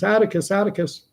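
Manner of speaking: put-on high child's call for her father; calling little girl. Scout calling her father through the mob, anxious.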